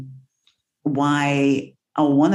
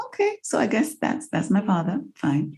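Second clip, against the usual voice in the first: deadpan unimpressed; 6 semitones higher, 4 dB quieter than usual. Retold or imitated. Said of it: "I'm like".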